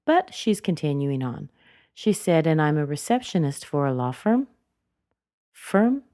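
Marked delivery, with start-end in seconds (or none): 2.5-4.5 "firm" lifting at the end, more to come; rising, unfinished intonation. Shown as a demonstration. Teacher modeling an unfinished self-introduction, rise on "firm". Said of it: "Firm goes up because she's not yet finished". 5.5-6.0 "Firm" said alone, sweeping high upward; rising intonation. Shown as a demonstration. Teacher isolating the last word for learners, rising "Firm". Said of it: "Firm goes up".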